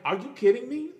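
high, rising disbelief; high-pitched outraged question; incredulous. Retold or imitated. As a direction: react in appalled disbelief, pitch climbing. Incredulous reaction to replacement theory.

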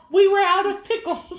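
high, loud sing-song excuse; mock innocent pickle excuse, pitched high; mock whiny excuse. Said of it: "I'm all".

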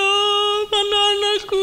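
put-on high, tearful wail for her lost child; mother crying for her missing child. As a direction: sob it out as a desperate mother. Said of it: "nangis-nangis nih ibu".

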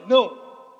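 NÃO said loud and abrupt; harsh-toned no. Shown as a demonstration. Preacher showing how a refusal sounds, loud and abrupt. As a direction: fire out a hard, loud refusal. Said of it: "até a entonação do não é".

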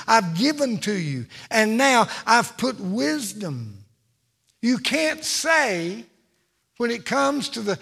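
loud, rising preacher cadence, hammering each line; full-force preaching. Acted. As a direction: preach it to the back pew.

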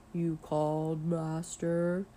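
slow-witted looming servant voice, deep and resonant; deep, booming butler. A narrator's reading. Boy impersonating his butler for the class, deep and booming. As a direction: boom it out like a towering butler. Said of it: "in a deep, booming voice".

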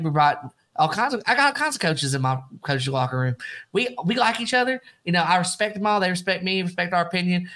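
a lightly drawled Southern coach, fast and cocky; Jimbo Fisher impression. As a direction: toss it off like a brash coach who's the boss. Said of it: "here's my best Jimbo Fisher impression".

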